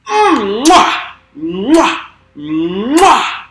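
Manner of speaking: loud high smacking kiss sounds; chef's kiss. Pretend. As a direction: blow big chef's kisses at the loot. Chef's kiss over loot.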